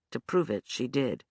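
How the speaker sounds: ordinary delivery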